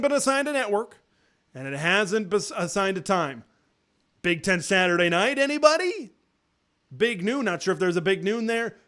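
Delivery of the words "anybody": high teasing anybody?; rhetorical anybody. Real, not acted. Vlogger asking viewers about the TV slot, teasing. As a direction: toss it out to the crowd.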